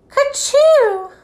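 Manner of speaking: high, drawn-out "kerchoo"; imitated noise. A narrator's reading. Narrator as vase of flowers gets knocked over, high imitated noise. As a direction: sound it out high and long.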